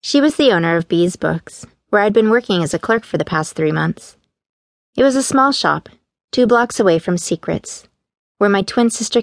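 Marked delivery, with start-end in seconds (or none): none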